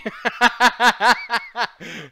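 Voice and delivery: high, long burst of laughter; big rolling laugh; loud cackle. Real, not acted. Reacts to the guess.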